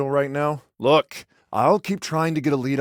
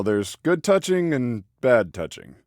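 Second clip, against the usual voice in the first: usual speaking voice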